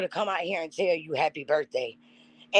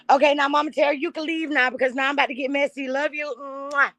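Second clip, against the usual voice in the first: playful send-off; 9 semitones higher, faster than usual. Real, not acted. Playfully sending Mama Terry off.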